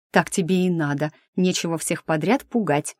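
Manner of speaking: ordinary delivery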